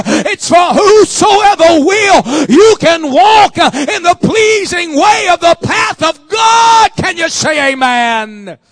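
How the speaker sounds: loud, drawn-out preacher's shout; full-force preaching. Real, not acted. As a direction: proclaim it slowly at the top of your voice.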